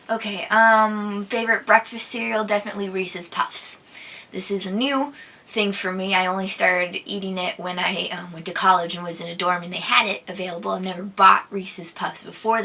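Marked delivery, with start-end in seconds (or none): none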